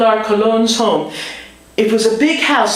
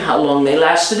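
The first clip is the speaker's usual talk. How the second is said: normal talking voice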